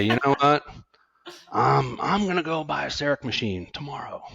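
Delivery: bargaining. Retold or imitated. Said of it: "you go into bargaining".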